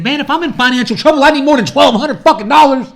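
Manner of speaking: loud, high-pitched indignant thought; exasperated inner rant. Retold or imitated. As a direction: blow up at the tiny sum. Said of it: "in my head I'm thinking".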